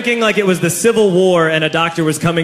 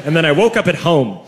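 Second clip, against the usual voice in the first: ordinary talk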